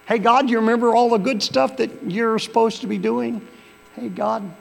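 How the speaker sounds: loud, high familiar call; calling on God. Acted. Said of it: "we do when we've sinned".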